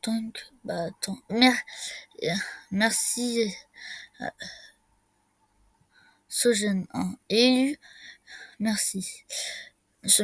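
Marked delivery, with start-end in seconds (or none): none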